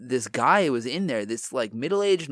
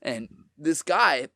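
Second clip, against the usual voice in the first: ordinary talk, nothing marked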